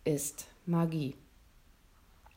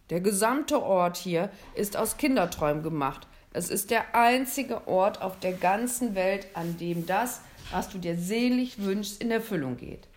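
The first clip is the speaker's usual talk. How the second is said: louder, hearty Santa Claus; lightly voiced Santa; 5 dB louder than usual. Santa telling William the North Pole grants dreams, louder and hearty. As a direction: boom it out a little, inviting.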